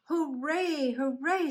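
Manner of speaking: high, jubilant hooray; storybook cheer. A narrator's reading. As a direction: cheer for Noah as a happy family. Noah's family cheers.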